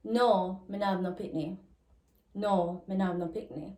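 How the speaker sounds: Jamaican patois negative answer, falling at the end. Shown as a demonstration. Teacher models answering no about children, in Jamaican patois. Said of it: "you can say".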